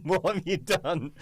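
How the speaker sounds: mock alarm at a client's blunder; alarmed question. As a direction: stretch it out in disbelief. Alarmed reply to client's call.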